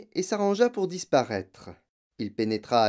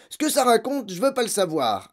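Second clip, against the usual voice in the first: very high, loud angry bark; 9 semitones higher, 3 dB louder than usual. Acted. Father cutting off his daughter, angry bark. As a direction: cut her off with a bark. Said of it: "aboya monsieur Verdebois".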